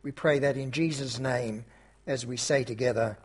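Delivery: soft, reverent pastoral prayer; closing prayer. Acted. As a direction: pray gently on behalf of the church.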